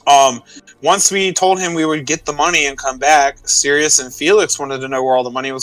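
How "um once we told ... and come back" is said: ordinary talk, nothing marked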